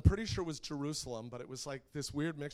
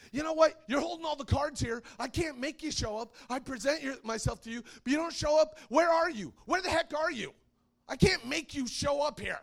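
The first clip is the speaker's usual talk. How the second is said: higher, louder furious complaint; angry at God; 12 semitones higher, 7 dB louder than usual. Retold or imitated. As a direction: rage at God for not showing up. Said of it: "I was swearing at God".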